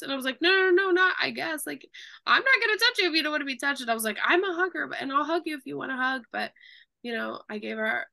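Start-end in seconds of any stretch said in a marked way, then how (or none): 0.4-3.8 high, loud, fast insistence; emphatic reassurance. Retold or imitated. Nurse insisting to patient she needn't hug, high and loud. 4.2-6.2 high, loud, fast insistence; emphatic reassurance. Retold or imitated. Nurse insisting to patient she needn't hug, high and loud.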